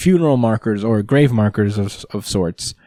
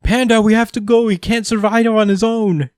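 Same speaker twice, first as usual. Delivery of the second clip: put-on higher, pleading kid voice; Gory begs Panda to come along, upset; upset child; 11 semitones higher than usual. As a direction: plead like a panicked little kid. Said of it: "He's like".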